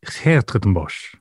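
"Hertogenbosch" said slowly with a guttural Dutch H at the start; careful native-style Dutch "Hertogenbosch", slowed down; Dutch pronunciation. Shown as a demonstration. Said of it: "I can perhaps give it a better go".

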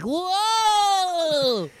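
high squeaky helium whoa. Retold or imitated. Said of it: "get high and just be like".

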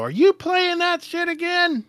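high, slow, shrill-sounding mock wife; nagging wife. Wife walks in on husband gaming, high mock nag. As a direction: stretch it out high like an annoyed wife. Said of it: "the wife came in and go".

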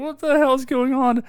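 high-pitched imitation of confusion; mock bafflement. Host retelling the wood chipper kill, mock bafflement. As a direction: mimic someone utterly bewildered. Acting out baffled movie character.

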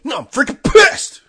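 very loud, high, slow screamed line; furious yell. Retold or imitated. Host imitating the angry football player's scream, very loud. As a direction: scream it, blowing out eardrums. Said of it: "Sorry if that blew your eardrums out".

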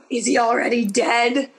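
hammed-up gasp, then dramatic question; mock-dramatic alarm; mock suspense gasp. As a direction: gasp as if the scene were tense. Voicing intended suspense of scene.

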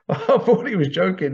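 high, laughing disbelief. Real, not acted. Host recalling a joke taken seriously, laughing. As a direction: laugh through the words.

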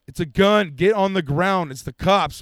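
loud police bark; imitating police yelling at them to get down; cop commands. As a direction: order them to the ground.

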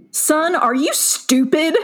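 mock incredulous scolding. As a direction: retell it as a slow, incredulous put-down. Retold mentor confronting student.

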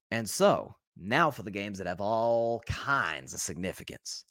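slow, low Southern drawl on the transition. Acted. Sports picks host slipping into a Southern accent, slow and low. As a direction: drift into a lazy Southern accent. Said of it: "I just went Southern there".

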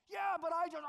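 high, loud defensive protest; flustered excuse cut short. Retold or imitated. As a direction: blurt it out like a caught husband. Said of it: "And you're like".

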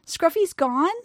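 high, lightly voiced little girl. A narrator's reading. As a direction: ask as a hopeful child. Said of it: "the little girl asked".

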